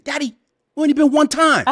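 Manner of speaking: high, loud child's whine, put on; mock child voice, squealed high; complaining kid. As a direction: imitate your kid protesting to Daddy. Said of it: "my kids are probably watching this saying".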